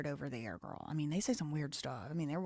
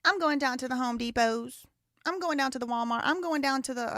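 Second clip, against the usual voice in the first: put-on higher-pitched Southern accent quote; American South speech; 5 semitones higher, 4 dB louder than usual. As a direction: play a Southerner announcing an errand. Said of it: "you know what they do say?".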